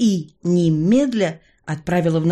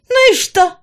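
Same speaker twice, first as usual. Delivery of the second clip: very high, loud retort; defiant villainess; 7 dB louder than usual. A narrator's reading. Villainess dismisses the man's warning about rules, defiant. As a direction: toss it back in a high-pitched voice.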